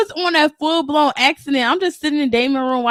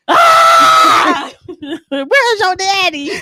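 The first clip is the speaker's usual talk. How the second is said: a big high yell breaking into a laugh, then slower; hollering a hammed-up greeting, much higher; mock excited shout; 6 semitones higher, 3 dB louder, much slower than usual. Voicing him greeting her home.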